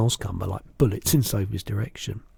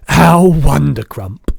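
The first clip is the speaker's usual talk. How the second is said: loud, drawn-out delight; beaming cry; 4 semitones higher, 13 dB louder, slower than usual. A narrator's reading. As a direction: exclaim slowly with huge joy. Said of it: "cried the B F G, still beaming".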